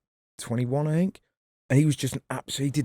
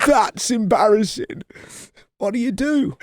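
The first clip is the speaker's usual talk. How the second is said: higher voice telling a mock sob story; imitating Johnny Vegas; 9 semitones higher than usual. Retelling the Vegas bit to the crowd, high hammed-up voice. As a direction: pitch it up and ham the wretched tale. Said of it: "And he went".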